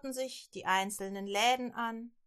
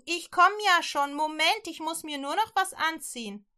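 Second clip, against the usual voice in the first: higher, loud call down the stairs; 7 semitones higher, 6 dB louder than usual. Acted. Rahab calling down to the guards to stall them, loud. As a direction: stall them with a bright shout. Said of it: "rief sie nach unten".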